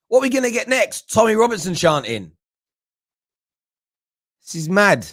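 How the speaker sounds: ordinary delivery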